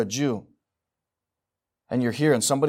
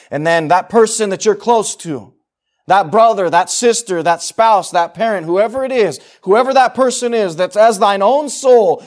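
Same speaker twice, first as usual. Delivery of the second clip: fiery preaching; 6 semitones higher, 12 dB louder than usual. Acted. Preacher to congregation, full-force preaching. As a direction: preach it louder, building with each name.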